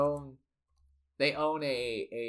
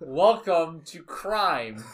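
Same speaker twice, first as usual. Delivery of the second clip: slow, louder showman's greeting; mock welcome; 2 semitones higher, 6 dB louder, much slower than usual. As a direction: announce it grandly and slowly. Voicing the syndicate's greeting.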